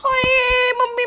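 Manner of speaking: a drawn-out, high, loud mock wail of pain; hammed-up moan to dodge the chores; faked pain wail. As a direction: pretend arm pain, wailing to her mother. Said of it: "ਹੁਣੇ ਤੇਰੀ ਬਾਂਹ ਪੀੜ ਹੋਣੀ ਆ".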